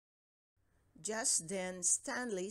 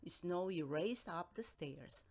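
ordinary delivery

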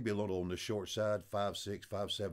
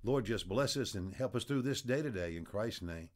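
lower, hushed prayer voice; closing prayer; 4 semitones lower than usual. Acted. Preacher praying over his listeners, low and hushed.

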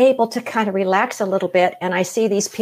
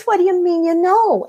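amazed question; 12 semitones higher, 3 dB louder than usual. Retold or imitated. Stunned by the stranger.

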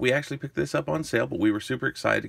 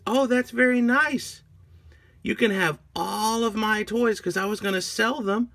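high-pitched, eager voice; grateful little girl; 9 semitones higher than usual. Acted. Agnes offering her toys to minion, high and grateful. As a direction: gush thanks in a raised child's voice.

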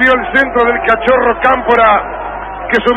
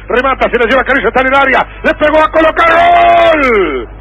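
rising, faster, then shouted goal call; goal call build-up; 3 semitones higher than usual. Acted.

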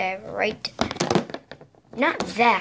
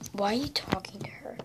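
whispering; 6 dB quieter than usual. Acted. Whispered side talk between characters.

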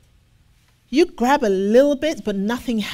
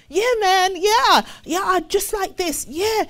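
much higher easygoing "I hear you, man"; mock casual agreement; 9 semitones higher, 3 dB louder than usual. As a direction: brush him off with breezy promises. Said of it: "And you'll speak for hours".

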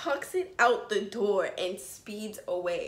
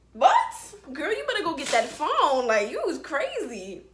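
loud, high-pitched disbelief; incredulous outburst; 7 semitones higher, 7 dB louder than usual. Real, not acted. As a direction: burst out in loud, sassy disbelief. Outburst at losing the phone.

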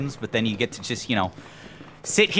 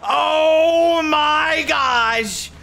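panicked shout; 8 semitones higher, much slower than usual. Real, not acted. Creature screaming.

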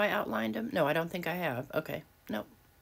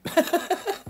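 a sudden high, louder giggle; bright high laugh; 14 semitones higher, 5 dB louder than usual. Real, not acted. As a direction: let out a squeaky laugh. Laughs at own remark.

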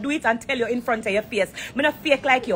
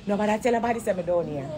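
softer, hushed so no one hears; talking lower; 5 semitones lower, 6 dB quieter than usual. Real, not acted. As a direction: drop the voice to keep it secret. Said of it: "Me I go talk lower".

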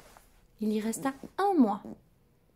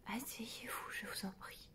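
whisper; 15 dB quieter than usual. A narrator's reading. Doctor invites patient, whispered.

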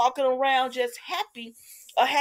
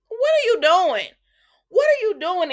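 loud, high mock outrage; outraged questioning; 8 semitones higher, 7 dB louder than usual. Viewer reacting to the surprise kiss, loud high mock outrage. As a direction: call them out, high and loud. Scolds characters over the kiss.

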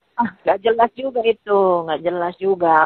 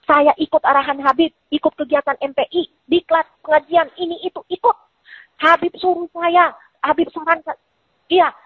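rant building to a yell; 7 semitones higher than usual. Retold or imitated. Retold rant rising to a shout.

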